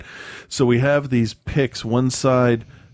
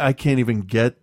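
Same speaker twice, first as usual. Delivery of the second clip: ordinary talk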